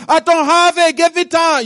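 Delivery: a poor servant begging for mercy. Acted. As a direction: beg on your knees for time. Fellow servant begs on knees.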